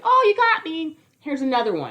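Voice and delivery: high, mock-innocent "oh, you got me"; sing-song mock voice of a hustler; mocking scammer. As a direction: play the smug cheat feigning defeat. Mocking the shell game scammer.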